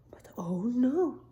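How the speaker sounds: hushed oh no; whispered alarm. A narrator's reading. Storyteller whispering alarm at the missing caps. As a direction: whisper the shock.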